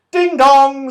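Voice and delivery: high, loud drowsy ding dong; sleepy chime. Acted. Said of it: "said her clock sleepily".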